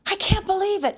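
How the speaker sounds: high breathless disbelief; stunned donut hearing the awful truth; gasping. A narrator's reading. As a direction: gasp the words out in shock. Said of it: "Arnie gasped".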